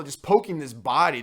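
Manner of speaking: ordinary talk, nothing marked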